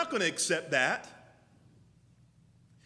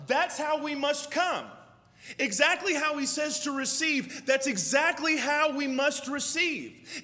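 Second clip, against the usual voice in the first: fiery preaching; 4 semitones higher than usual. Acted. Pastor rousing the congregation, fiery preaching. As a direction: hammer each exactly home to the church.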